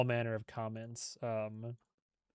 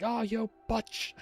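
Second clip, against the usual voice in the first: high, slow South African drawl on butch; South African accent; 8 semitones higher, much slower than usual. Retold or imitated. Game villain chasing her, mock South African accent. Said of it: "and he's like".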